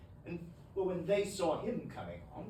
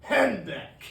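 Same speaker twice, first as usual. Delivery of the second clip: loud snorting humbug; scornful humbug; 12 dB louder than usual. Acted. Scrooge following up with a loud scornful humbug. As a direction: hurl the word at him. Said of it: "followed it up with".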